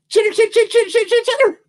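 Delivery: very high, rapid chittering; squeaky high squirrel talk; squirrel chatter. Acted. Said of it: "which the squirrel talk means".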